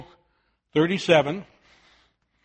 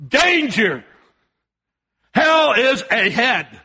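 shouted warning; 5 semitones higher, 3 dB louder, slower than usual. Acted. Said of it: "we need to be shouting out".

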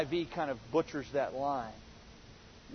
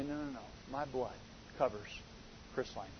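tender hushed reassurance; 6 semitones lower, 15 dB quieter, faster than usual. Acted. Jesus defending the preacher at judgment.

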